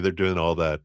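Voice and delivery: ordinary talk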